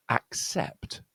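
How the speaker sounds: "Accept" with double C said as k then s; K plus S. Shown as a demonstration. Teacher showing two sounds in double C, "Accept" with k-s. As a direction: say "Accept" with a clear k-s in the middle. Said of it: "one is k and one is s".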